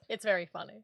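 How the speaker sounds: understated, indulgent reply; mildly patronising agreement; humouring. Retold or imitated. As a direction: humour him with restrained amusement. Own humouring reply, against his glee.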